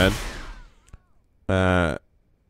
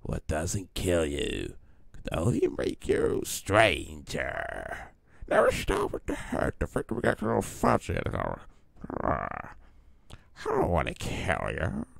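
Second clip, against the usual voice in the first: softer villain voice with erratic pitch leaps; Joker; 3 semitones lower, 7 dB quieter than usual. Acted. Streamer doing the Joker on request, erratic Joker impression. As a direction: speak softly, lurching between low and squeaky. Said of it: "do the Joker".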